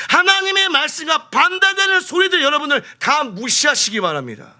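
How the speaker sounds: high, loud impassioned exhortation; full-force preaching. Real, not acted. Preacher urging congregation to ignore hindering voices, impassioned and high. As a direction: exhort the crowd with rising fervour.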